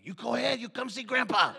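loud, high coaxing grandpa; mischievous grandpa. Retold or imitated. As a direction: egg the boy on gleefully. Grandpa egging kid on.

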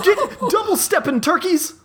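high shouting monster voice; shrill blob yell. Acted. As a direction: yell orders like a furious tree blob. Tree blob shouting at player.

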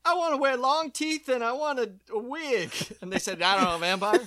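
high, loud hammed-up mock Eddie Murphy; lampooning the studio pitch, high and loud. Mocking the studio to friends, hammed-up high loud voice.

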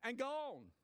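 a folksy Southern "He done come and gone"; Southern dialect. Pretend. Preacher dropping into Southern dialect for the punchline. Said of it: "in my best Southern dialect".

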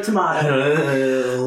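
very low, slow demonic growl; devil voice. Shown as a demonstration. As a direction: stretch it out deep like the devil. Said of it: "what I hear for the devil voice is tomato".

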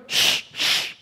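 two long, sharp shushes; shushing. Acted. Crowd hushing the blind men, shushing. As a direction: shush them hard. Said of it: "And the crowds are going".

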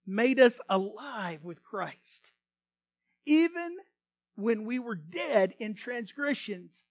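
slow, loud scripture proclamation; fervent preaching. Acted. Preacher declaring scripture to congregation, slow and loud. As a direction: proclaim each word like a sermon.

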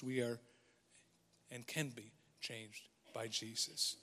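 soft sermon. Acted. Pastor gently reflecting to his church, soft preaching voice. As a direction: ease in gently, as if confiding to the congregation.

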